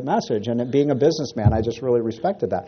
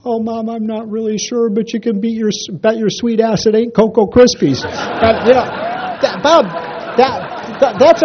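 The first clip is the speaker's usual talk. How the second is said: put-on high, timid kid voice squeaking out the punchline; a terrified five-year-old blurting it out; higher little-boy voice, nervous then bolder; scared little boy; 9 semitones higher, 4 dB louder than usual. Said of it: "he's stammering, can barely get the words".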